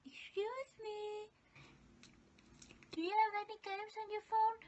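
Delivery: high, fast, lightly voiced fangirl ask; mock wrong line. Joking about a fake line to the actor, high and fast. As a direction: blurt the wrong question nervously. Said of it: "No, that's not what I said".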